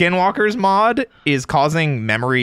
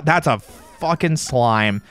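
usual speaking voice

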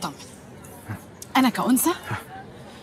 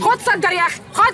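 pushy angry order; 9 semitones higher, 5 dB louder than usual. Acted. Forcing cigarette on him.

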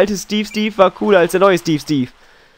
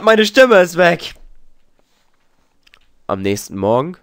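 ordinary delivery

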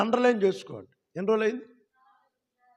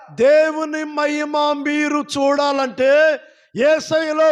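slow, loud, weighty proclamation; emphatic preaching; 6 semitones higher, 5 dB louder than usual. Acted. Pastor urging faith on the congregation, slow loud preaching. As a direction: drive each phrase home slowly.

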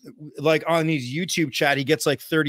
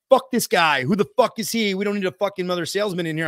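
fast, raised retold griping; indignant group members; 3 semitones higher, faster than usual. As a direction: imitate a group rejecting an outsider. Voicing hostile group members' reaction.

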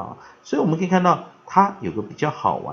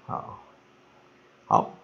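ordinary talk

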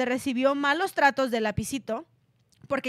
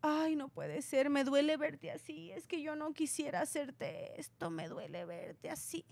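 mock crying; 10 dB quieter than usual.

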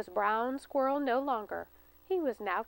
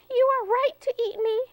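very high, louder squeaky plea; pleading squirrel; 13 semitones higher, 7 dB louder than usual. Acted. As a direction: beg the bear for mercy.

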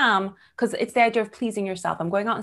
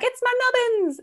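much higher proud reply; proud chirp. Retold or imitated. Telling her date about her nubbins, chirpy and much higher. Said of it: "And I'm like".

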